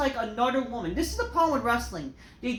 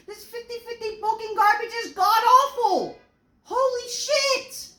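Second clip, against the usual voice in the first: starts heated, ends in a much higher, louder yell; building angry rant; 6 semitones higher, 5 dB louder than usual. Real, not acted. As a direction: let the frustration boil over into a shout. Ranting fury at wrestling booking.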